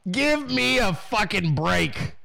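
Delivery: drawn-out, exasperated repeat; slow emphasis. Real, not acted. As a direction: stretch each word out slowly in exasperation. Same phrase repeated slower for emphasis.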